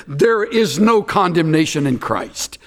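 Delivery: ordinary delivery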